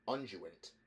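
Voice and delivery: ordinary delivery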